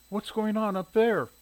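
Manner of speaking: high, slow questioning mother's voice; curious mother. A narrator's reading. Shirley asking her son about the lights, curious. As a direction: voice a mother wondering aloud.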